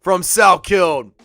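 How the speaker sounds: loud, high, stretched-out fight call; excited shout. Real, not acted. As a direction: roar the call slowly over the action. Cheering a takedown live.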